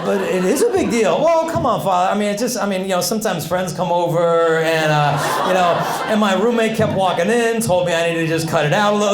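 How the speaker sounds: put-on Italian-California accent. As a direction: plead your case in an Italian-California accent. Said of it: "a bit of an Italian accent mixed with a California accent".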